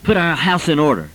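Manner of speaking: ordinary talk, nothing marked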